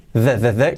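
"the" repeated as short voiced TH bursts; TH sound. Shown as a demonstration. Teacher isolates the TH of "there", repeated. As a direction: tap out "the, the, the". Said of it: "con la T H".

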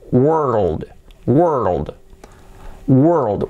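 WORLD stretched through the R into a dark L; slow model WORLD for learners; careful pronunciation demo. Shown as a demonstration. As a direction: draw out WORLD as wer-ruld, falling. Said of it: "¿Cómo se pronuncia esto?".

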